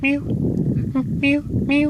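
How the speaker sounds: tiny high mews; imitated meows. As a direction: mew like a timid cat peeking in. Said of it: "peeks her head around the corner".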